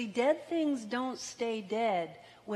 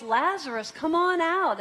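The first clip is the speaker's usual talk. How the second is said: loud, high summoning shout; loud call; 7 semitones higher, 8 dB louder than usual. Retold or imitated. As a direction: call the dead man out at full voice. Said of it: "Jesus called in a loud voice".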